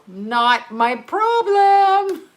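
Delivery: slow, high, drawn-out sing-song motto; mock motto voice. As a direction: stretch it out like a silly slogan. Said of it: "That's my motto".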